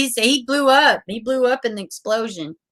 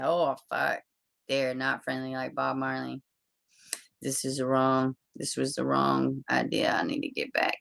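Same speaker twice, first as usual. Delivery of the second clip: very soft, low mutter; retold scared aside under the breath; hushed dread; 5 semitones lower, 12 dB quieter than usual. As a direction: mumble it timidly, fading to a whisper. Retelling his scared reaction to yelling.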